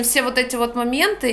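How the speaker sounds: ordinary talk, nothing marked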